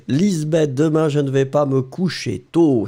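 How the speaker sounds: ordinary talk, nothing marked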